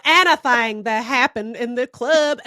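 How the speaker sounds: slow, drawn-out fake Southern accent; heinous Southern accent. Mocking the jazz singer's heinous Southern accent, bad fake Southern. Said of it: "she's doing this heinous Southern accent".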